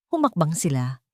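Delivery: ordinary delivery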